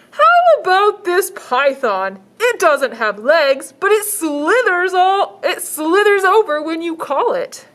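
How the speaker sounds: high, slow salesman pitch; shelter man voice. Acted. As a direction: sell it like an eager shopkeeper. Shelter man offering python.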